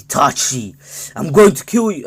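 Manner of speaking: hammed-up vengeful yell, rising sharply; acted battle cry. Sasuke arrives to attack Itachi.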